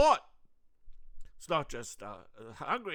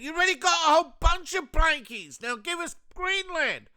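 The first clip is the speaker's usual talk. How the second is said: high loud bossy demand; mock America; 14 semitones higher, 11 dB louder than usual. America brushing off Europe, high loud demand. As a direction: demand it like a greedy bully. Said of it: "America's saying".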